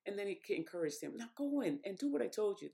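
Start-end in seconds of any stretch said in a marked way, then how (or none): 1.2-2.7 higher, lively retelling of God's order, later corrected; loose first paraphrase of God. Retold or imitated. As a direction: toss off God's command, pitched up. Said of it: "That's not exactly the way he said it".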